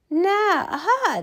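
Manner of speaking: usual speaking voice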